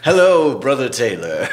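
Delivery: loud, high hammy greeting; big over-the-top host voice; exaggerated cartoon intonation. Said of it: "Why are you talking like that?".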